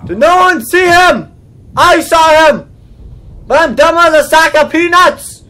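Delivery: high, loud incredulous rant; exasperated shout. Real, not acted. Player ranting that no one saw him, exasperated shouting. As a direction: yell in sheer disbelief.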